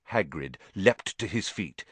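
ordinary delivery, nothing marked

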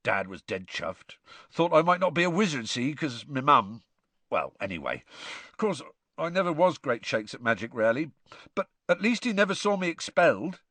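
lightly voiced rough half-giant, sniffly; a hint of teary gamekeeper laughing at himself; Hagrid's voice.

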